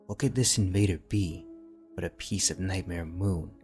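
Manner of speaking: usual speaking voice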